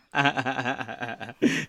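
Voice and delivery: drawn-out chain of ha-ha-has; staccato laugh. Real, not acted. Guest reacting to Hollywood correspondent idea, staccato laugh. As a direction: laugh in separate, rhythmic bursts.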